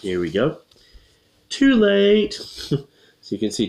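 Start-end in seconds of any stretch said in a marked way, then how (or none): 1.5-2.4 high, loud, drawn-out cry; hammed-up wail of mishap; clown's cry. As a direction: call it out comically high. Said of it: "the clown says".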